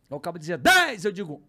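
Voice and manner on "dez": high, loud auction shout; shouted rival bid. Retold or imitated. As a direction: call out a bid across the room. Acts rival bidder shouting a bid. (lance gritado do concorrente)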